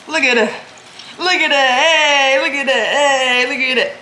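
giddy repeated calls to look; excited showing off. Real, not acted. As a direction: hype up the juice coming out. Showing off the juicing.

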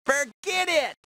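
a sharp schoolyard shout; yelling. Acted. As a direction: throw it at the other gang.

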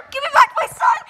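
high, loud cry to get him back; shouted demand. Real, not acted. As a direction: yell it out in a panic. Game character taken away.

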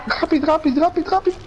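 high frantic urging; urgent hurry. Real, not acted. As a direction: rush them, high and urgent. Urging teammate in danger.

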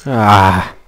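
loud frightened cries of ah; scared horror game streamer. Real, not acted. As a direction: yelp in fright at each scare. Screams at jump scares.

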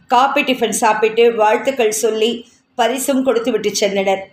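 ordinary delivery, nothing marked

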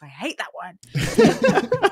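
loud, high, drawn-out complaint; exasperated outburst. Real, not acted. Voice actor venting about a tongue-twister, exasperated outburst. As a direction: burst out in mock-anguished protest.

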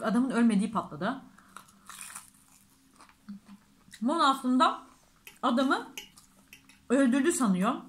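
talking while chewing food; muffled chewy speech; mouth full, chewing. Real, not acted. Seen 0: Left speaker bit a nugget at -4s; jaw still chewing while talking.

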